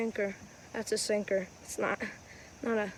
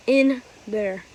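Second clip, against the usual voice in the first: straining emphasis; 10 dB louder, slower than usual. Real, not acted. Digging nails into the ball.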